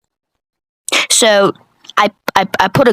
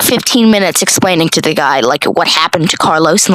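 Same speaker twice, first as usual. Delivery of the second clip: ordinary talk, nothing marked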